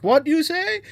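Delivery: high, loud challenge rising at the end; mock confrontation. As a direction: demand it with a sharp upward jump. Acted confrontation, grabbing him by collar.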